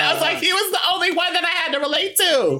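very high, squeaky mock protest; re-enacted high-pitched fan outburst; hammed-up frantic pleading. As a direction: plead in an overexcited falsetto through amusement. Re-enacting an excited protest to executive.

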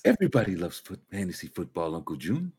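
lightly mocked Jersey mobster; Tony Soprano. Host spoofing a mobster talking to his uncle, Jersey mobster voice. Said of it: "that was a Tony Soprano".

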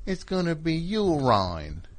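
"urine" said as "yur-ine", with the last syllable rhyming with "wine"; mock "urine" as "yur-ine", stretched slowly; imitating Sharon Horgan. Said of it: "Sharon Horgan would always be like".

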